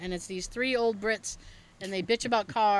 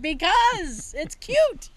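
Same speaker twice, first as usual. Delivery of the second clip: slow, higher, louder sing-song cutesy voice; 8 semitones higher, 5 dB louder, much slower than usual. Retold or imitated. Telling dad about the garden train, mock cutesy. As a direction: drawl it like a pouty kid. Her own childish reply retold.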